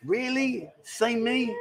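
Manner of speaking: high-pitched, hammed-up surprise; mock disbelief. Host reacting to how old she is, high mock disbelief. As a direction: question it in mock astonishment. Feigned shock at her age guess.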